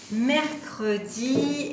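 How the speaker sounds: drawn out. Shown as a demonstration. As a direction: lengthen each syllable of "Mercredi". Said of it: "quand on accroche tout ensemble".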